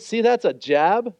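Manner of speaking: normal talking voice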